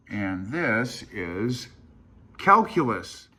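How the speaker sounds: mock game-show unveiling; dramatic reveal. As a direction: drag it out, then announce it big.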